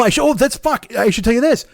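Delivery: ordinary talk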